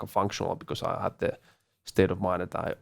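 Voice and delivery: ordinary talk, nothing marked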